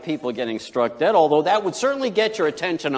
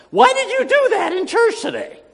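high, loud shrill-free mock yell of a woman; angry lady yelling; 5 dB louder than usual. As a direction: yell it like an outraged churchgoer. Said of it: "some lady yelling at him".

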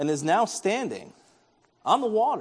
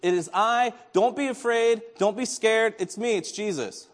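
higher-pitched, lightly raised call of reassurance; 6 semitones higher, 3 dB louder than usual. Retold or imitated. Jesus to frightened disciples across the waves, calling out. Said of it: "The Lord cries out".

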